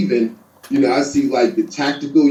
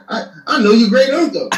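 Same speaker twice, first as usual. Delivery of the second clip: mock know-it-all neighbor; 10 semitones higher, 3 dB louder than usual. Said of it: "I'm like".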